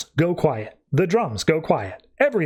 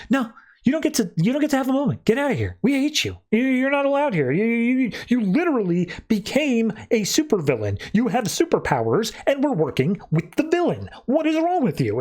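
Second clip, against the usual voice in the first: higher, fast, indignant telling-off; mock outraged bandmates; 7 semitones higher than usual. Bandmates to Maeve, who betrayed them: much higher, indignant scolding. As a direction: pile on her like furious bandmates.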